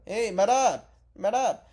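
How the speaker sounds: put-on high, loud Dagestani-accented broken English; Umar impression. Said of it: "I don't know if that's a good impression".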